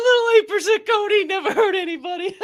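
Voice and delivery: high, loud laughing quote; laughing while quoting. Real, not acted. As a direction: choke the line out through laughter. Retelling clip while laughing hard.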